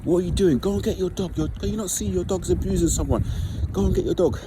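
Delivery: high-pitched scolding shout; berating. Retold or imitated. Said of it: "I started to berate the la-, the owner".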